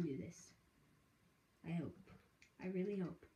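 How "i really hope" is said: ordinary delivery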